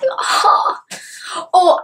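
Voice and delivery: tearful breathy oh; overcome, barely voiced; a moved little gasp of oh; choked-up oh. Real, not acted. Moved by the scene.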